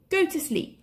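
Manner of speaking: high, gentle order to sleep; panther telling. A narrator's reading. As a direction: settle the boy down. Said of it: "he told Mowgli".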